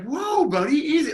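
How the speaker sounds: high, soothing mock calm. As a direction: settle him down teasingly. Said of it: "completely cool".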